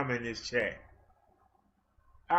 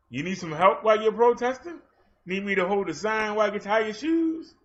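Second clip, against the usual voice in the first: mock helpful police; 5 semitones higher, 4 dB louder than usual. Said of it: "That's what they should be saying".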